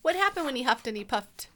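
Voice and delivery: ordinary delivery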